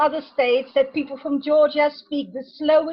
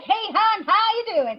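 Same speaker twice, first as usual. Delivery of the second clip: higher Alabama phone greeting; Alabama drawl impression; 6 semitones higher, 4 dB louder than usual. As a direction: greet them in a lilting Alabama accent. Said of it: "picks up the phone like".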